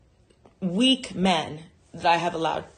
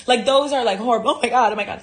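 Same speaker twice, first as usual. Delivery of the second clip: mock panic; 5 semitones higher, 7 dB louder, faster than usual. Real, not acted. Panicking about taxes nightmare.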